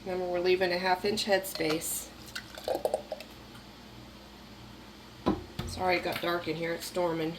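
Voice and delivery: flat robot voice from being sick; monotone robot voice, still getting over being sick. Real, not acted. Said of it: "I'm talking like a robot".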